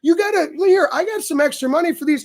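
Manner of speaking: lightly voiced nervous friend; worried buddy. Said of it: "And he's like".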